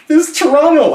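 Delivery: high, loud and thrilled; excited. Retold or imitated. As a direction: shout it with glee. Excited recognizing his city.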